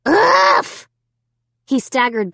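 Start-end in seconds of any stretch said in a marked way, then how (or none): 0.1-0.9 high, loud grunt from a blow; loud "uff". A narrator's reading. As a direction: grunt as if hit in the stomach. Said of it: "let out a loud".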